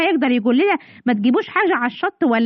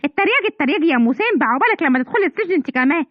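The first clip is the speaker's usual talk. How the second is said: usual speaking voice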